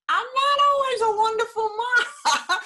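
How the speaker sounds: laughing admission. Real, not acted. Laughing confession about parenting.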